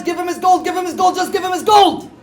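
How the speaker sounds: frantic yell at the sheriff; hollering. Acted. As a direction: holler it while running round the room. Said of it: "hollering at the top of his voice".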